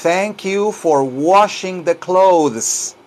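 slow, careful teacher enunciation; drawn-out language-lesson delivery; slow model sentence. Shown as a demonstration. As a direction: give each word room for learners to copy.

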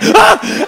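high, loud startled "Ah"; short re-enacted fright yelp; startled yell. Teller freaked out by his mates, startled yell.

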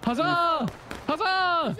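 battle cheer. Pretend. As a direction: bellow it like a soldier charging. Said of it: "How are you huzzahing?".

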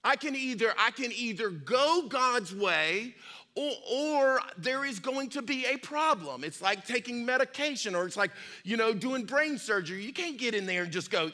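ordinary talk, nothing marked

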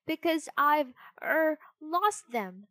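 stall, then drag out the lie; hesitant fib. A narrator's reading. Pinocchio lying to fairy, hesitant and high.

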